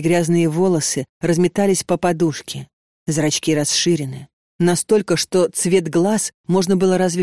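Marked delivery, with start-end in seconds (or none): none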